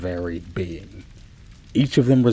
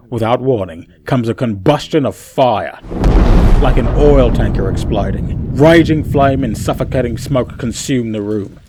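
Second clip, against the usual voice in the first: louder and louder as the fire erupts; building intensity; 2 semitones higher, 8 dB louder than usual. A narrator's reading. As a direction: build to a roar with the flames.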